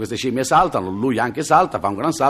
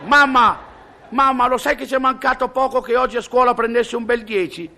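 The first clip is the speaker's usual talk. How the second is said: put-on child's voice; 12 semitones higher than usual. Child tells mother.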